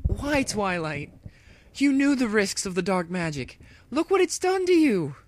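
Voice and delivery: high, loud, slowing scream rising to a cry. Acted. Dash to corrupted friend, screaming and high. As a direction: scream to reach your lost friend. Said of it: "Dash screamed".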